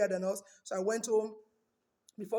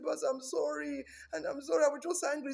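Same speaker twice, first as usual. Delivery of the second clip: apologetic wife; 7 semitones higher than usual. Retold or imitated.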